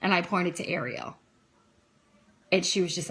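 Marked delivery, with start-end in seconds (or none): none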